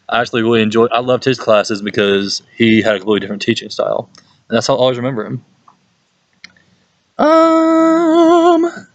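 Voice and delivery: ordinary talk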